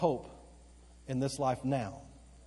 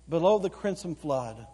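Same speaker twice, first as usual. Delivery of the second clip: ordinary talk, nothing marked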